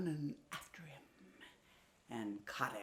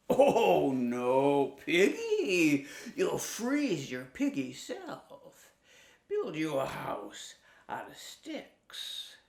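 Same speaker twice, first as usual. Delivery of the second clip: mock-concerned wolf; 4 dB louder, slower than usual. Wolf tricks pig.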